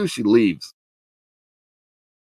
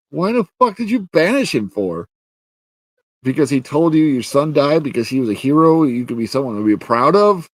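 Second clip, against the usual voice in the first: high-pitched incredulous rant; indignant, raised-voice disbelief; exasperated outrage; 5 semitones higher, 3 dB louder than usual. Real, not acted. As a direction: demand an answer, pitch climbing.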